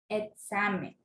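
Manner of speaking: examen as e-XA-men, weight in the middle; stress on middle XA. Shown as a demonstration. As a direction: lean on the XA of examen. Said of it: "intensidad de la pronunciación".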